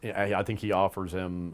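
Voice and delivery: ordinary talk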